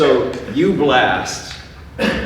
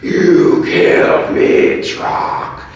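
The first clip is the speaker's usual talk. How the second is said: slow, loud, airy ghostly voice; old prospector; 6 semitones higher, 9 dB louder, much slower than usual. Acted. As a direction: wheeze it out like a toothless old ghost. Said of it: "an old prospector beard and a crazy old, like, askew hat, and he's missing half his teeth".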